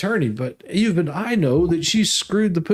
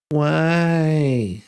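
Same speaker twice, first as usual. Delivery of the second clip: put-on annoying toddler. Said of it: "he had this most annoying voice".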